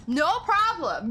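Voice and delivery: slow, drawn-out playful yell at Magic; mock shout. Streamer mock-yells at Magic in chat, slow and loud. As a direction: bellow it back, teasing.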